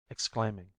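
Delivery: ordinary delivery, nothing marked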